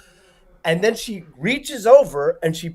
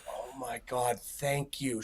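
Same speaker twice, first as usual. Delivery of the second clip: hushed relief; 5 semitones lower, 14 dB quieter, slower than usual. Retold or imitated. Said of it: "And she goes".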